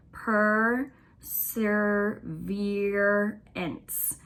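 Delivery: Perseverance drawn out very slowly; very slow stretched teaching demo. Shown as a demonstration. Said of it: "let's kind of stretch it out".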